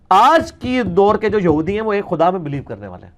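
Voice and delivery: usual speaking voice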